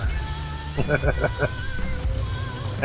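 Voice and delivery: soft, high-pitched laugh; quiet giggle. Real, not acted. Player amused by the belt centralizer, quiet giggle. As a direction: giggle softly to yourself.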